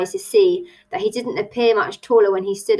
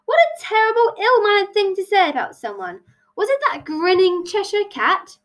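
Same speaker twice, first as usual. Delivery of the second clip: high, theatrical indignation; affronted; 11 semitones higher than usual. Acted. Hare hears he was called rude, affronted. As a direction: exclaim it, pitched well up.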